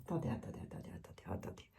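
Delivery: muttered under the breath; whispering to herself. Real, not acted. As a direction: whisper it to yourself, searching. Muttering to herself over cards. (chuchoté pour soi)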